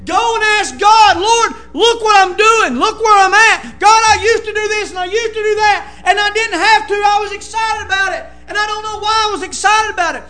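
loud, earnest prayer cried out to God; fervent preached prayer. Acted. Preacher modelling a cold believer's prayer, loud and earnest. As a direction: pray it out loud to the congregation.

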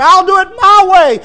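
loud, high sneering defiance; mock defiant man. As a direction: play the arrogant sinner.

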